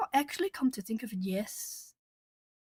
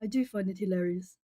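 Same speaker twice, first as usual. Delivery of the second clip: normal talking voice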